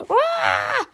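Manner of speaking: high, loud startled scream; scream of horror. A narrator's reading. Teacher sees a pterodactyl land on her car, screaming. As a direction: shriek at the sight of a dinosaur. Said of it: "screamed in horror".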